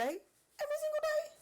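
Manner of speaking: squealing way up high in delight; excited squeal. Real, not acted. Host gushing to viewers about her husband, high delighted squeal. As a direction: gush over the husband cooking every day, voice soaring.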